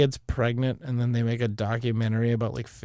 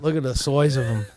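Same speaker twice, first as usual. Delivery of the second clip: put-on laughing Aussie accent; amused, hammed-up Australian; mock Australian; 2 semitones higher than usual. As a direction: marvel in a playful Australian accent. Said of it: "to have an Australian accent".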